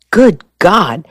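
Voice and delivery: a touch of startled alarm; mild shocked exclamation; exclaiming. A narrator's reading. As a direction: blurt it out, caught off guard. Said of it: "Shore exclaimed".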